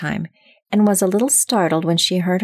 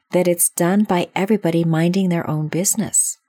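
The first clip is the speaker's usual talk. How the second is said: ordinary delivery, nothing marked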